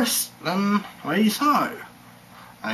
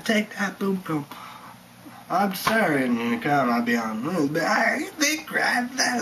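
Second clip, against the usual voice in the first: normal talking voice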